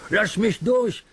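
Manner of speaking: high insistent request; urging. Acted. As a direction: push him to let you through. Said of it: "drängte Duck".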